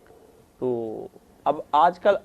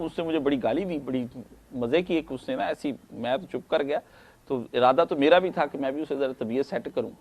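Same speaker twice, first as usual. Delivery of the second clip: usual speaking voice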